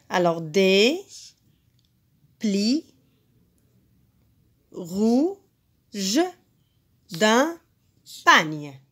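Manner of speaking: des, plis, rouges, d'un, pagne said one by one with long pauses; syllables spaced. Shown as a demonstration. Teacher to class, beating out the last line syllable by syllable. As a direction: lay out each syllable separately. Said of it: "Un, deux".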